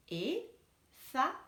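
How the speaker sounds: Effacer stretched slowly into ef-fa-cer; slow teacher split of Effacer; syllable by syllable. Shown as a demonstration. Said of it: "couper le mot".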